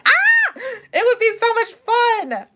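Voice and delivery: high excited squeal then gushing; giddy squeal. Real, not acted. Imagining herself backstage, giddy.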